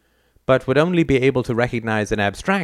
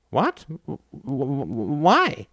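stunned stammer; 4 semitones higher, much slower than usual. Acted. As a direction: stammer in disbelief. Alder stunned.

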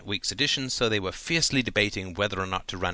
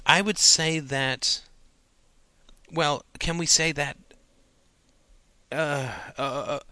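higher, halting, with long pauses and stuck fillers; groping hesitation; 6 semitones higher than usual. A narrator's reading. Student tries to name a great idea, stumped and hesitant. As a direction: stall while searching for an answer.

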